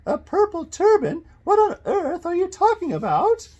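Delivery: put-on very high, loud, quavering old woman; hammed-up old lady falsetto, loud and indignant; suspicious old lady. A narrator's reading. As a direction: snap suspiciously in a high elderly voice. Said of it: "snapped suspiciously; quavering, high-pitched voice of an elderly woman".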